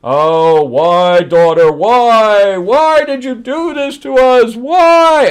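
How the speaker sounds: loud, climbing high-pitched lament; hammed-up anguished pleading; mock wailing heads. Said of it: "they're all saying".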